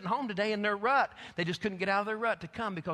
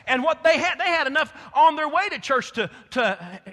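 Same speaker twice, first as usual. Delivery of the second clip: loud, higher preacher's build-up; rising full-force preaching; 6 semitones higher, 8 dB louder than usual. Acted. Preacher urges the church to be like Peter and John, loud and rising. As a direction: push it up like a revival preacher.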